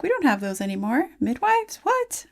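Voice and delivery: high squeaky disbelief on midwives; mock baffled, voice jumping up; incredulous. Doula tells her grandma in disbelief, rising squeak.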